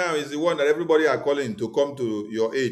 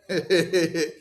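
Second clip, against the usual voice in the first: mocking laugh. Real, not acted. Mocking listeners after rhetorical question.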